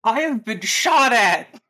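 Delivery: loud, high, each word stretched; indignant protest. Acted. Cecile complains to her rescuers, indignant protest. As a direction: protest slowly and loudly, outraged. Acting Cecile's outrage on comm.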